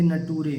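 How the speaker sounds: "नटूरे" as na-TOO-ray; pupils' misreading. Retold or imitated. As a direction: imitate pupils reading "नटूरे" with hard T. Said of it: "बहुत सारे बच्चे इसे कहेंगे नटूरे". (बच्चों जैसा ग़लत "नटूरे", ट की आवाज़ से)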